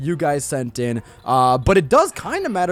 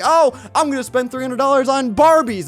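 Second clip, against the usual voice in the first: put-on greedy kid; 9 semitones higher, 5 dB louder than usual. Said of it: "going into Toys R Us like".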